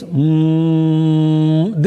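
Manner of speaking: sustained hum on a level pitch; humming sound. Shown as a demonstration. Teacher explaining what hum means, long steady hum. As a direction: hum "mmm" steadily with lips closed. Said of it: "this is humming sound".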